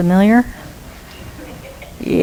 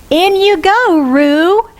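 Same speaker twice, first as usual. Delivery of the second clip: mock shout; 9 semitones higher, slower than usual. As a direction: sing out the order to jump in. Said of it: "shouts out".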